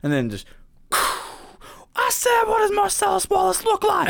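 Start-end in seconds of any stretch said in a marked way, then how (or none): 1.9-4.1 much higher, strained mock yell. Jules yelling at Brett, a hammed-up shout. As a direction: bellow the question again.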